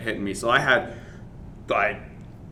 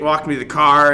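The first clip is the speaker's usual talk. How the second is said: normal talking voice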